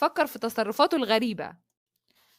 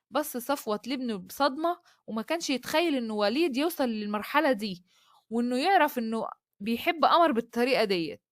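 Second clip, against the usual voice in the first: usual speaking voice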